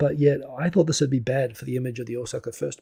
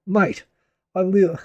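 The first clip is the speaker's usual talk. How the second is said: louder, higher stab at a New Zealand accent; attempted New Zealand accent; 5 semitones higher, 7 dB louder, slower than usual. Shown as a demonstration. Streamer answering a commenter, trying on their New Zealand accent. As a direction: have a go at a New Zealand accent, breaking off amused. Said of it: "I can hear the New Zealand accent".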